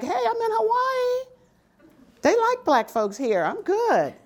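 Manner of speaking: high, bright hopeful cheer; excited new arrival. Retold or imitated. New hire arriving in Hawaii, sure it will be easy, high excited voice. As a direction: sound thrilled to have landed somewhere friendly. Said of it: "I'm like".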